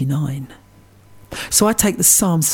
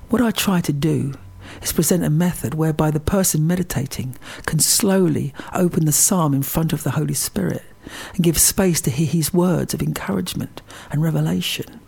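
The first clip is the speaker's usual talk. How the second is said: usual speaking voice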